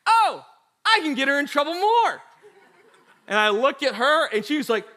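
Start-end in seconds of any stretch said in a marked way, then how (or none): 0.1-2.2 high, loud aha moment; light up at the chance to tattle; scheming realization. Retold or imitated. Kicked brother realizes sister is in trouble, gleeful scheming.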